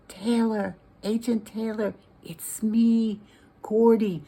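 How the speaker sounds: plain reading voice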